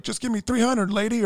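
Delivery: high mock demand; demanding scammer. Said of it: "he was like".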